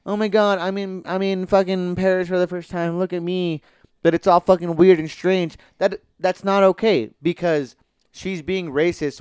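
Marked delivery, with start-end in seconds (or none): 0.0-3.6 mock gushy, higher-pitched tourist excitement; super sweet gushing. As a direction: gush like a sugary TV heroine, lightly mocking. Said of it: "something super sweet and super".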